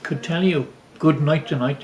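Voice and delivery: normal talking voice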